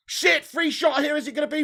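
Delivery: high, loud alarmed call; urgent play-by-play. Real, not acted. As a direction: cry out as danger opens up. Alarm at opponent's free shot.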